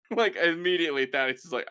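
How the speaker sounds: normal talking voice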